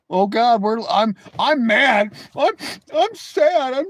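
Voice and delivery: mock the chatter's mad and sad fuss; mock whiny complaint. Streamer parodies a viewer announcing his feelings, whiny. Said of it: "tell the whole chat that".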